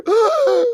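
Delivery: very high, weak attempt at a gasp; a gasp that falls flat; failed gasp. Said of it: "I can't even do it again".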